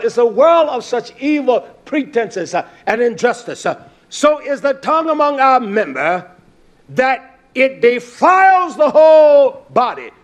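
loud, high, driving sermon delivery; full-force preaching. Acted. Preacher warning the congregation about the tongue, full-force preaching.

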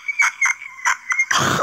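high, wild squealing laugh; shrieking laugh. Real, not acted. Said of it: "I'm sorry for the dying donkey voices".

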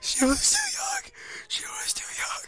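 breathy, choked fake wail; mock grieving. Player mourning a young victim, mock grief. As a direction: lament with the voice giving out.

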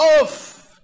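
loud exasperated oof; an oof to show annoyance; frustrated oof. Shown as a demonstration. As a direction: blow out a loud fed-up oof. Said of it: "When I say".

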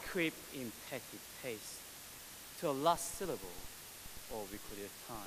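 hushed, dramatic Shakespeare recital; Macbeth soliloquy. Acted. Poet reciting Macbeth from theater club, hushed and dramatic. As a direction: intone it softly like a brooding actor. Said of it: "memorized the soliloquy of Macbeth".

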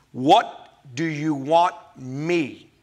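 very slow, "me" set apart; deliberate question with weight on "me"; stress on "me". Shown as a demonstration. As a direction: space out each word, landing on "me". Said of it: "Notice me".

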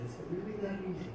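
very soft, wondering question; humbled asking. Retold or imitated. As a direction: ask the heavens in a small voice.

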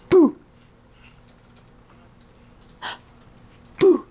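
sharp playful gasp then boo; peekaboo gasp. Pretend. Peekaboo with baby.

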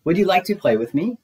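high, cheery question; cheerful storybook Pooh, rising hopefully; cheerful invitation. A narrator's reading. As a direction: invite a playmate with pitch lifted. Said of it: "Pooh said cheerfully".

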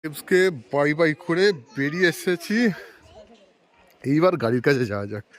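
usual speaking voice

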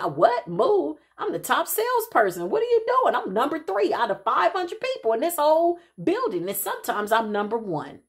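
high, outraged disbelief, pitch jumping up; hammed-up shocked protest, very high; incredulous. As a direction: act out stunned disbelief at being told to move. Retold disbelief at being told to leave.

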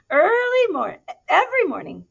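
high, loud burst to start the tale; excited opening. A narrator's reading. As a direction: launch the story with a bright call. Story opening line.

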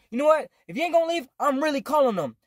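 imitated stepdad's high, heated threat; angry threat. Retold stepdad threatening police call.

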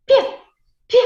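short palatalised "Пі", said alone; crisp single soft "Пі" for pupils; soft P sound. Shown as a demonstration. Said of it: "М'який звук".

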